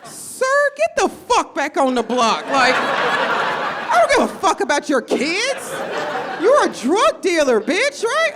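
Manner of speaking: loud, high exasperated rant; mock outrage. Comic scolding her weed dealer busy at the park. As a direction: tear into him, half laughing.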